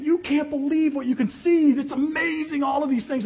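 thrilled high-pitched yell from afar; excited yelling. Retold or imitated. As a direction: call out in amazement from up in the air. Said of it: "And he's yelling this".

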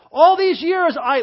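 loud, high, drawn-out grievance; slow, aggrieved outburst at a father; angry complaint. Acted. Acts out the angry older brother.

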